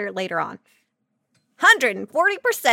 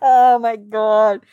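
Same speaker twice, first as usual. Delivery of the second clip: slow, loud, drawn-out oh my God; mock-shocked; scandalised; 2 semitones lower, 8 dB louder, much slower than usual. Real, not acted. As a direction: gasp it out, scandalised. Reacting to innuendo.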